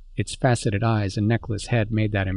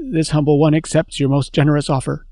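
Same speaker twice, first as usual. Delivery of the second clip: raised, louder ceremonial delivery; formal memorized phrasing; 5 semitones higher, 5 dB louder than usual. Acted. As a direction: recite the phrase like a formal courtesy. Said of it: "letting the memorized syllables flow".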